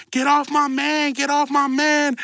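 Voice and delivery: put-on whiny slow plea of a girlfriend; imitating baby mama. Baby mama voiced jumping in.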